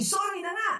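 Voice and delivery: high, irritated mock shout; shouted apology. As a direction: throw the sorry back crossly. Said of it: "you shouted back and say".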